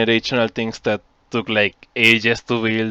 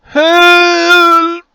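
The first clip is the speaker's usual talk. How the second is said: high, loud, drawn-out scream; screamed cry for help; 10 dB louder than usual. Acted. Game master voicing a scream from the burning farmhouse, screamed help. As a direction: scream it long and high, louder the second time. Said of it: "You hear a scream that says".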